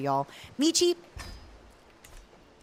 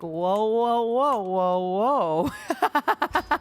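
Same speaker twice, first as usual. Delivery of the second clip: giggly mock husband voice, whoa broken by laughs; laughing husband impression; 2 semitones higher, 4 dB louder, much slower than usual. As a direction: act out the husband while cracking up. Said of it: "husband started being like".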